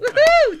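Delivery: loud, very high "woo-hoo"; celebratory whoop. Real, not acted. Host celebrates with the caller, celebratory whoop. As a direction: whoop it out in delight.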